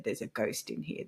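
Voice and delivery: breathy whispered ghost warning; low unvoiced whisper about a ghost; hushed whisper. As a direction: whisper it like a secret. Spooky claim retold to workers.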